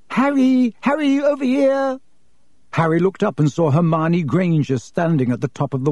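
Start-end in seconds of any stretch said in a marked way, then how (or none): 0.1-2.0 put-on high-pitched girl hailing a friend; girl calling out. A narrator's reading. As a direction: call eagerly across the street. Girl calls to friend from steps.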